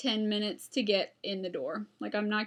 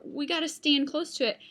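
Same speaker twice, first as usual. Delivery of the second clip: ordinary delivery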